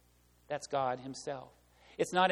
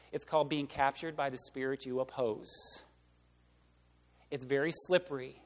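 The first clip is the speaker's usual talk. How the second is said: ordinary delivery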